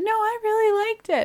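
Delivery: put-on high squeaky kid voice, polite and unconvincing; mock kid voice. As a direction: play a child politely insisting. Second kid answer imitated.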